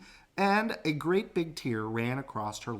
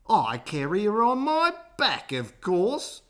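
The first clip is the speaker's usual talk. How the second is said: higher, louder, matter-of-fact; crocodile voice; 8 semitones higher, 5 dB louder than usual. A narrator's reading. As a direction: state the obvious proudly. Crocodile answering matter-of-factly.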